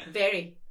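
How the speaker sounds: "Very" dropping in pitch; firm falling Glaswegian "Very". Shown as a demonstration. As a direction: bring "Very" down at the end, short and sharp. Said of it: "go down".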